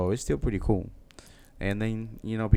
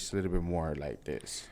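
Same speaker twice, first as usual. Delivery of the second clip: deeper, quieter demonstration register; lower English voice; 7 semitones lower, 10 dB quieter than usual. Shown as a demonstration. Man showing how his pitch differs in English, low and soft. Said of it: "even the pitch of our voice is different".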